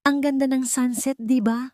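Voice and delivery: bright and happy, rising high on the sunset; delighted, pitch lifting as she admires the view. A narrator's reading. Maya greeting her husband at sunset, happily. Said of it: "Masayang sabi ni Maya".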